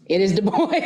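put-on "Du Bois" said firmly, stress on Bois as "BOYS"; emphatic correct "Du Bois". Shown as a demonstration. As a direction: land "Du Bois" with weight on the second syllable. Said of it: "emphasize that".

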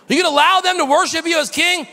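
high, loud and fast indignant whine; mock the leaders' outrage; hammed-up scandalized question; outraged complaint.

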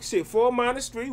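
usual speaking voice

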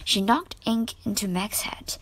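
plain reading voice

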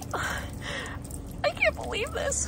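slow high whispered disbelief; hushed stunned whisper; awed whisper. Real, not acted. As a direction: whisper it slowly, overwhelmed.

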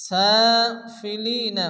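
سافلين slow with the first a held one count; one-count stretch. Shown as a demonstration. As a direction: hold the a of سافلين one beat. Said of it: "এক আলিফ পরিমাণ টান দিয়ে পড়তে হবে".

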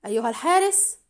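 a restrained call for the guard; full-voiced shout. A narrator's reading. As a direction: call out firmly without really yelling. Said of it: "وصاح بملء فيه".

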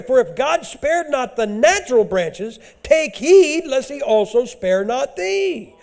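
loud, high scripture preaching; thundering sermon reading; full-force preaching. Real, not acted. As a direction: drive the verses home.

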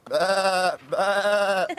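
loud baa like a lamb; bleating lambs. Acted. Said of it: "bleated some lambs".

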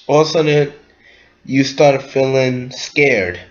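ordinary talk, nothing marked

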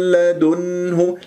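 لدنه drawn out, clear نون; slow clear reading. Shown as a demonstration. Teacher modelling clear لدنه slowly. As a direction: sound the نون plainly in لدنه. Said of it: "بهذه الطريقة".

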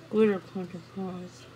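usual speaking voice

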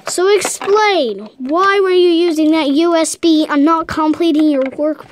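hammed-up scolding principal; stern headmaster. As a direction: demand an answer from the pupil. Headmaster interrogates student.

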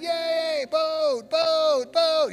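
put-on high, loud child cheer, chanting the word; excited kids cheering. Little kids cheering at the boat, shouting high and excited. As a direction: squeal it like thrilled little kids.

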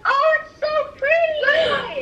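high, slow, teary delight; emotional praise. Real, not acted. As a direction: gush with tearful joy. Crying shout over haircut.

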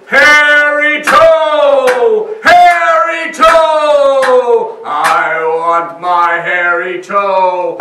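a towering creature's drawn-out cry; hollow monster voice. A narrator's reading. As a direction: loom in the doorway and boom it. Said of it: "It said".

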